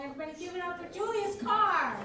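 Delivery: rousing host announcement; big stage introduction. Real, not acted. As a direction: send the comic on with a shout. Host introducing next act.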